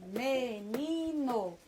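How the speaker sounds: "Menino" bem lento, sílaba por sílaba; devagarzinho. Shown as a demonstration. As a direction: diga "Menino" esticando cada sílaba: me-ni-no. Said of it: "falar a palavra menino devagarzinho". ("Menino" devagarzinho, separando as sílabas)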